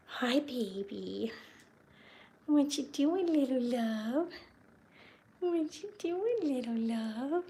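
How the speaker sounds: slow, soft sing-song coo. Real, not acted. Talking baby talk to her cat.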